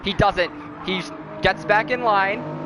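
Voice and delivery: steady race call, building; play-by-play commentary. Acted.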